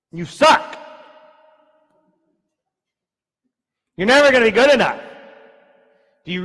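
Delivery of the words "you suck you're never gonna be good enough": loud, high, fast taunting yell; enemy shouting. Acted. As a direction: bark insults like a bully. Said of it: "Shouts at you".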